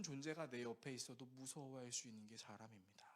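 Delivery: hushed whispered sermon point. Real, not acted. Pastor whispering the lesson to the congregation, hushed.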